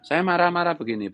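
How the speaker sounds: pitched well up, forceful and stern; half-angry lecture pitched high; high intonation. Real, not acted. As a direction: raise the pitch high to scold. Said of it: "saya pakai intonasi tinggi seperti ini".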